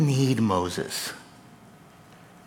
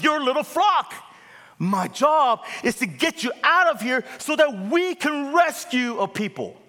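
God voiced in full preaching force; 10 semitones higher than usual. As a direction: thunder God's words over the congregation.

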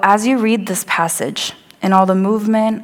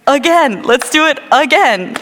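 eager; 6 semitones higher, 4 dB louder than usual. Retold or imitated. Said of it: "wouldn't you be like".

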